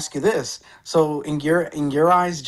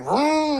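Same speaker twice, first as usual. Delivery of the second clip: a quick raised engine vroom; car engine vroom; 10 semitones higher than usual. Retold or imitated. Telling a friend how the driver sped off, a high mock engine vroom. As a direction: rev it like the car taking off. Guy speeding off in Lamborghini.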